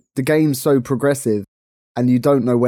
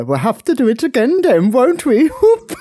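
hammed-up pantomime line, much higher and louder; playful mock panto sing-song, far higher pitched; panto call; 12 semitones higher, 4 dB louder than usual. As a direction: tease him like a panto dame egging on the crowd. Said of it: "that's what it says in the Panto".

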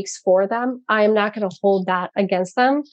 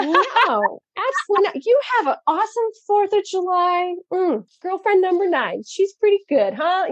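much higher, mocking sing-song; sarcastic mock; 10 semitones higher than usual. Mom mocking her ex-husband's careless attitude, high and sarcastic.

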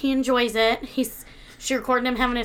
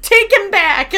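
higher and louder mock command; lightly voiced exasperated doctor; mock doctor order; 8 semitones higher, 10 dB louder than usual. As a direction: bark the order like a fed-up doctor. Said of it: "the doctor's like".

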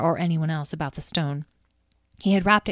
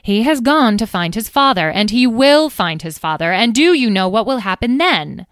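dramatic warning; 5 semitones higher, 8 dB louder than usual. Acted.

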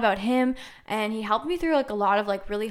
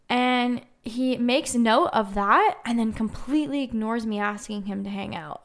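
usual speaking voice

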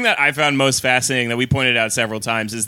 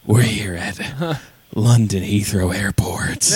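hushed, slow intimate whisper; whispered pilot voice; 3 semitones lower, slower than usual. Pretend. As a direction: whisper it close to the mic. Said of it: "so he's gonna be like".

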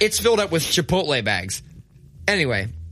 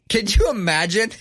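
laughing, higher; 7 semitones higher than usual. Real, not acted. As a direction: get it out through a laugh. Laughing at own joke.

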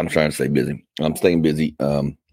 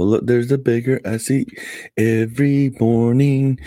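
much higher, sing-song cartoon voice, slowing at the end; Disney character voice; 8 semitones higher than usual. Spoofing a Beauty and the Beast villager, high sing-song. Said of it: "singing like Pierre from Beauty and the Beast".

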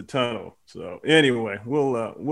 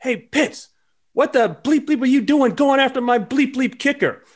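high-pitched mock shout at a rival coach; retold angry yell; 12 semitones higher, 4 dB louder than usual. Rival special teams coach yelling at Dad in the tunnel, mock angry shout. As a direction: belt it out like a furious coach across the tunnel. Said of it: "he yells out to my dad".

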